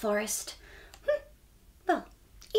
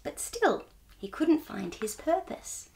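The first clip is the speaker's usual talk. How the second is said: plain reading voice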